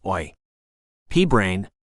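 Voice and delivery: put-on hammy Cockney yell, British accent; fake British accent. A narrator's reading. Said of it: "yelled in a fake British accent".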